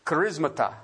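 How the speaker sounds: ordinary talk, nothing marked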